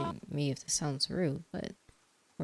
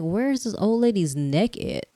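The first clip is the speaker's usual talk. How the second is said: incredulous; 5 semitones higher, 10 dB louder than usual. Real, not acted. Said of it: "I'm like".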